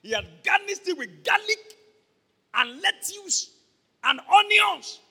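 loud, high, relishing the food; excited. Acted. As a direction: gush over the tasty plate. Savouring described food.

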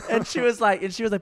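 ordinary talk

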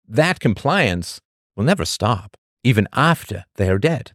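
normal talking voice

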